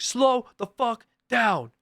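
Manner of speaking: very slow, high yelled order; screaming cop. Retold or imitated. Cop's parting order to a speeder, screaming. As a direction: stretch out each word in an imitated cop's shout. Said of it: "he starts screaming at me".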